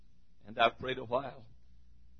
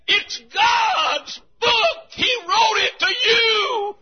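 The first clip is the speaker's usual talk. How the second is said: much higher, loud, slow cry with a sob in it; 9 dB louder, much slower than usual. Real, not acted. Preacher overcome before his congregation, crying out loud and high. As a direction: weep it out at the top of your voice. Overcome after singing the hymn.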